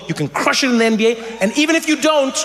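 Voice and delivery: ordinary talk, nothing marked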